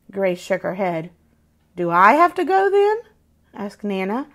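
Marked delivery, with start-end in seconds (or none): 1.8-3.0 higher and louder, mock hurt grandma; teasing mock offence; playful grandmother. A narrator's reading. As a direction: Nana pretending to feel left out. Nana teases Grace.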